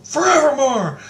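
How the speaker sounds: mock incantation called out high; calling out. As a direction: sing out the word high and loud. Told to say it, calls out.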